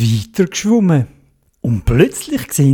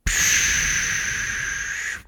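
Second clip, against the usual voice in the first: snorkel hiss; 10 dB quieter than usual. A narrator's reading. Snorkel breath sound.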